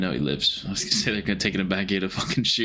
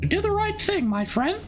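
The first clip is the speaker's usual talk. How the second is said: squeaky, very high cartoon mouse voice; Russian Mickey Mouse voice. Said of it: "Russian Mickey Mouse".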